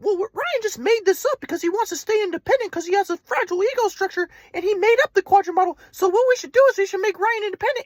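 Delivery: high, mocking grandpa lecturing; grandpa's voice. Son mocking his grandpa's psychoanalysis, high-pitched. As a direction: lift high to mock grandpa's analysis. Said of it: "He was like".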